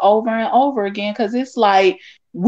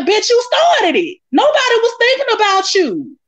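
pitched way up, indignant; high exasperated retort; 11 semitones higher than usual. Retold or imitated. Telling off the critics, high and indignant. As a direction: shoot it back high and indignant.

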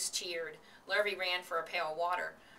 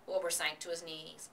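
usual speaking voice

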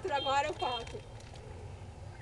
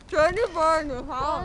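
cheeks stuffed full; 5 dB louder, slower than usual. Real, not acted. Chubby bunny, mouth overfull.

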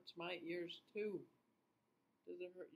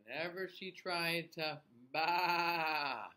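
story reading; 7 dB louder, slower than usual. A narrator's reading. Reads story to children.

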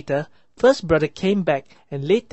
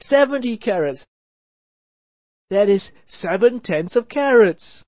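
high, eager counting, louder; excited; 6 semitones higher, 5 dB louder than usual. A narrator's reading. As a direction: rattle it off with glee. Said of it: "the seventh brother said excitedly".

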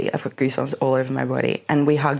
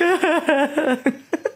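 laugh; 12 semitones higher than usual. Real, not acted. Laughing at herself.

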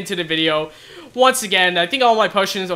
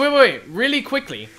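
usual speaking voice

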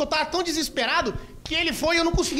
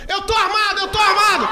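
bluffing shout; 6 semitones higher, 6 dB louder than usual. Retold or imitated. Friend bluffing at the robbers.

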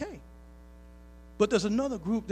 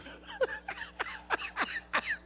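laughter; 6 dB quieter than usual. Real, not acted. Laughter after punchline.

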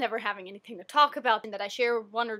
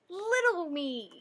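little me; 6 semitones higher, much slower than usual. Pretend. Names her child self, puts on voice.

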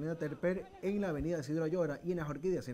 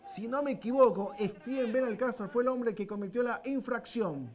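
excited; 7 semitones higher, 7 dB louder than usual. Real, not acted. Penalty called during live commentary.

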